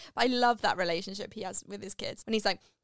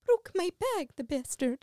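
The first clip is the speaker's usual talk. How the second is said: higher granny voice back on; old lady voice; 9 semitones higher, 4 dB louder, slower than usual. Retold or imitated. Doubtfire after the mugger, lightly voiced old lady. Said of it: "Going back into the voice".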